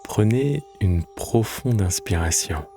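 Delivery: calm, measured Yoga Nidra voice with long pauses; guided meditation. Acted. Guide leading lying listeners into Yoga Nidra, calm guided meditation.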